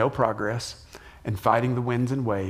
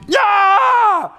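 sudden high, loud yell of terror; acted shout of fear; 9 dB louder than usual. Pastor acting out the frightened disciples' shout, loud and high. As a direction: scream like a sailor who just saw a ghost. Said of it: "They shouted".

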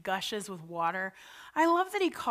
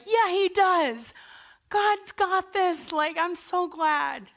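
higher, slow and bright-eyed, fully on board; glad, eager yes, much higher and slow; eager agreement; 7 semitones higher, 4 dB louder, slower than usual. Retold or imitated. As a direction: say it like you finally believe it. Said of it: "I would be like".

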